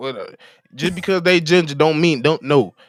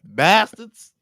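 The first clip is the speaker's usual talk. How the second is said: mock complaint; 5 semitones higher, 3 dB louder, slower than usual. Imitating player, complaining.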